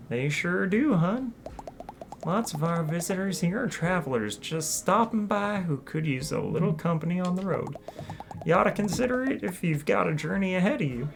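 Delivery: southern hostess greeting; southern accent. Acted. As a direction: welcome the newcomer with a southern lilt. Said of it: "a southern accent in this game".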